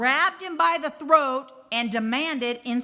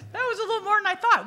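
high and louder, laughing through it, fast; 10 semitones higher, 5 dB louder, much faster than usual. Real, not acted. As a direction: laugh the line out in disbelief. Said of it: "Oh, that was a little more than I thought".

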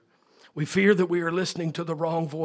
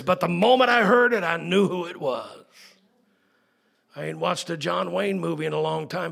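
normal talking voice

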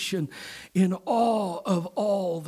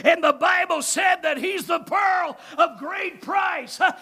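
loud, much higher building sermon climax; preaching at full force; 7 semitones higher, 6 dB louder than usual. Real, not acted.

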